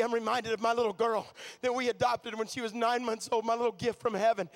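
ordinary talk

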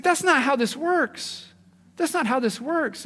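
high, slow, stressing not; Jesus rebukes the temple crowd, slow and emphatic; firm rebuke. Retold or imitated.

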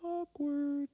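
a squeaky comic aside; mock awkward. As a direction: voice the embarrassed onlookers. Mock bystander comment.

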